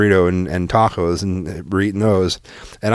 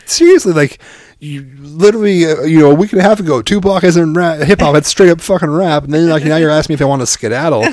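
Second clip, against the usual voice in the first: raised, incredulous comic rant; mocking tirade; 6 semitones higher, 4 dB louder than usual. Dad ribs son about skedaddle, mocking rant. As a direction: tease with exaggerated disbelief, pitch up.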